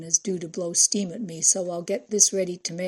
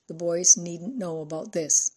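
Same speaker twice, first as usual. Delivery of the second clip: ordinary delivery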